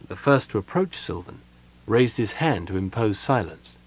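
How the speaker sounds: plain reading voice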